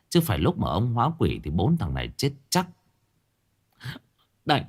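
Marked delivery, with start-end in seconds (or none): none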